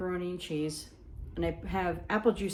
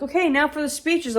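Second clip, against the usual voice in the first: mock announcer; 8 semitones higher, 6 dB louder than usual. Announcer at wedding.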